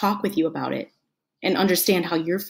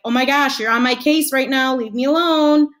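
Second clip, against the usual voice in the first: higher, fed-up protest; acted annoyed outburst, pitched up; exasperated; 5 semitones higher, 3 dB louder than usual. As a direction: push back as if you've been nagged all day. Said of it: "And then you're like".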